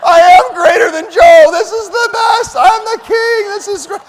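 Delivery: very loud, high, slow triumphant shout; full-throated victory bellow; yelling back. Retold or imitated. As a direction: roar it like a champion. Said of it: "I'm yelling back".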